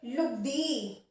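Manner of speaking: normal talking voice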